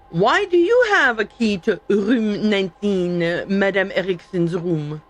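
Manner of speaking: mock French accent with throaty R. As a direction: question it in a slow put-on French accent. Reads game line in French accent.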